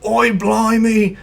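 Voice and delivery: mock Australian, played way over the top; over-the-top Australian accent. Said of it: "like, Australians".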